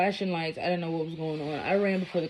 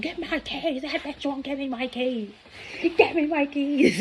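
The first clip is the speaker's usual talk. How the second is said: high, mocking scream of the ex; 10 semitones higher than usual. Narrator mocks her ex screaming for his keys, high mock yell. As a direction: mimic his furious demand, pitched up. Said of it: "He's still screaming".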